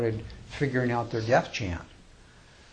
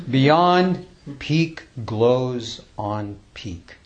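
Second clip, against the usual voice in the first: slow, measured recitation with long pauses; slow, reverent poem recital; poem reading; 4 dB louder, much slower than usual. Acted. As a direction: read it like a sacred verse, unhurried. Closing line of poem.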